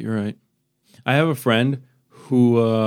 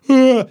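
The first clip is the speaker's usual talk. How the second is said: high, loud demo yawn; loud high-pitched fake yawn; 12 semitones higher, 7 dB louder than usual. Shown as a demonstration. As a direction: yawn out loud to set someone off. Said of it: "look at someone and go".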